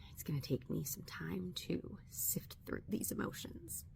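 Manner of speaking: choked whisper holding back anger; whisper, trying to keep it together; laid-off teacher whispering through anger. Real, not acted. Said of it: "I'm so angry right now".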